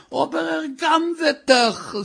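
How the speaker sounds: high, drawn-out cry of alarm; alarmed wife. Acted. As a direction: wail it slowly, way up high. Wife's alarm at thief, acted.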